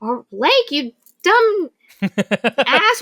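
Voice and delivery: high, loud exasperated yell; yelling at him. Retold or imitated. Elle yelling at Orion while grabbing a chair, loud and high. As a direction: yell at him, stretching out dumb ass. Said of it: "she ends up yelling at him".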